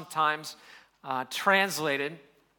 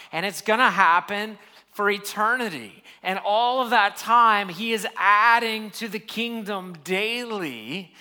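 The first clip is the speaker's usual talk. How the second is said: preaching with mounting fervor, higher and louder; building sermon; 4 semitones higher, 6 dB louder than usual. Acted. Pastor rallying the congregation about worldwide worship, rising fervor.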